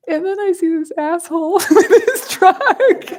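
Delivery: high, loud laughing outburst. Real, not acted. Teacher laughing at herself seeing the truck, high and loud. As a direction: laugh through the telling of her rage. Laughing at her own rage.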